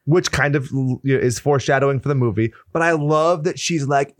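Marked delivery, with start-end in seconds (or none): none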